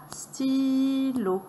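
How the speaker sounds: "stylo" chanted slowly in two beats; slow syllable-by-syllable "stylo"; scanned syllables. Shown as a demonstration. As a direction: hold the first syllable of "stylo" long, then drop. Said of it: "on va scander les syllabes".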